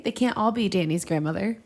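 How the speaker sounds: ordinary delivery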